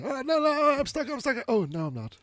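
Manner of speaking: high panicky cartoon voice; Sancho stuck in a passageway. Retelling the scene, voicing Sancho's high panic. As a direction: squeal like he's stuck. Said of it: "he says".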